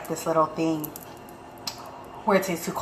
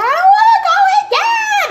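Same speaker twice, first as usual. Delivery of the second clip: put-on much higher, louder whiny child voice, half laughing; whiny kid; 9 dB louder than usual. Mom mimicking her son who refuses to go with dad, in a high whiny voice.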